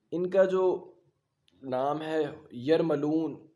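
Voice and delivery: ordinary talk, nothing marked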